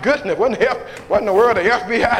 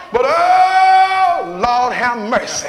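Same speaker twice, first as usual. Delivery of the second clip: preaching whoop; 2 semitones higher, 4 dB louder, slower than usual. Acted. Preacher whooping to the congregation about giving. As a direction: moan it out like a preacher.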